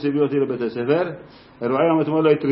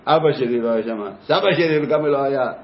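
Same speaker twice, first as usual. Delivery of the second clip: ordinary delivery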